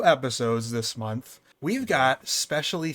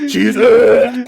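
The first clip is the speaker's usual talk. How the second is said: loud, higher retching imitation; mock dry heaving; 6 semitones higher, 12 dB louder than usual. Host mimics a player saying cheese, mock dry heaving. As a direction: heave out the uh like a fake retch. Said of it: "or you're dry heaving".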